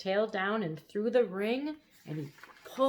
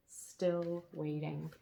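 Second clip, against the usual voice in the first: ordinary delivery, nothing marked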